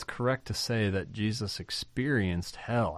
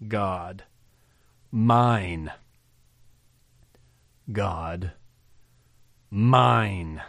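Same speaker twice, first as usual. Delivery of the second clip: "mine." punched much louder after a long pause; stress on mine; 6 dB louder, much slower than usual. Shown as a demonstration. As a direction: hold a beat after "God," then hit "mine." hard. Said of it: "An emphasis on the fact that he is still my God".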